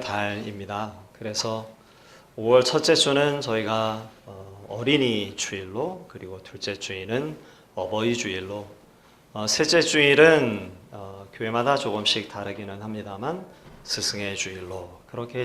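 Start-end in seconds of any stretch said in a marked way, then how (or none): none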